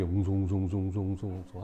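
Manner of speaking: low, soft repetitive murmuring; crowd murmur. Retold or imitated. Hundreds gathered before the night test, murmuring. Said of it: "막 웅성웅성해".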